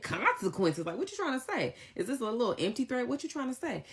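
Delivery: high, mocking what-are-you-saying; incredulous challenge. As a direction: throw the word back at him in disbelief. Retelling a heated argument.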